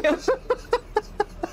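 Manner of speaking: high amused laugh; short high giggle. Real, not acted. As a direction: laugh at the song joke. Laughs at Primo Victoria.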